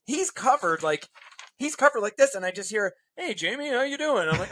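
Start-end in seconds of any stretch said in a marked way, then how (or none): 3.2-4.3 cheery greeting. Retold or imitated. Seller greets from opening garage.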